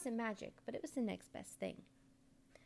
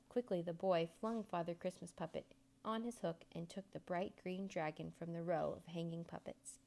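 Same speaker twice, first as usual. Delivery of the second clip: plain reading voice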